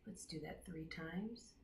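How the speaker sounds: unhurried meditation voice with long pauses; guided meditation. Real, not acted. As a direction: guide them gently into their breath, leaving space between phrases. Leads a breathing meditation.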